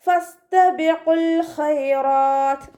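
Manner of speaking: slow, drawn-out melodic tajweed chant; Quran recitation. Shown as a demonstration. Teacher models melodic Quran recitation for students. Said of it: "അതുപോലെ ഓതുക കേട്ടോ".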